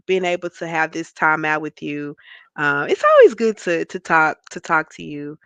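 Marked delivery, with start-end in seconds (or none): none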